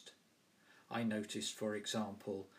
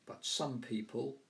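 ordinary talk

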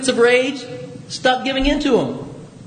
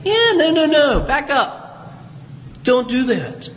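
higher, slowing to a stern halt, telling yourself no; the nagging little voice that stops you; inner conscience voice; 6 semitones higher, slower than usual. Retold or imitated. Conscience warning the listener in their own head, sharply higher. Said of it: "that little conscience that kind of rings in your head and says".

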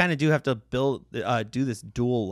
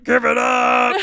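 gravelly shouted hype, much higher; [name] impression; 11 semitones higher, 8 dB louder, slower than usual. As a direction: belt it like a hammy jazz showman. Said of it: "a bad [name] impression".

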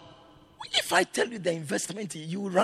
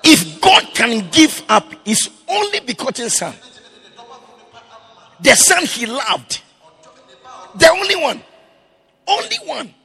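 loud, high, rising preacher's cry; fiery sermon climax; preaching at full force; 7 semitones higher, 10 dB louder than usual. Real, not acted. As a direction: preach it out, building to a shout. Preacher builds again.

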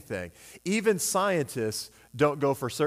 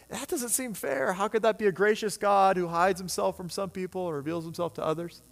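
mock whiny protest; 6 semitones higher than usual. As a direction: plead it like it's unfair. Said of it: "you're like".

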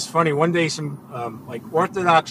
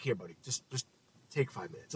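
retold calming words; 5 semitones lower, 16 dB quieter than usual. Calming a freaked-out stranger.

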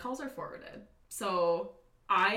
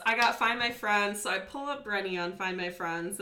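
normal talking voice

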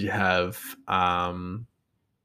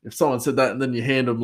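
usual speaking voice

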